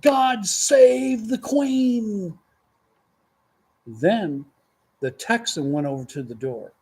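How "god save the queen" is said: high, very slow mock cry of a patriotic Englishman; Englishman's yell. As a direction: stretch it out like a proud Englishman leaping. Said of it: "and he yelled".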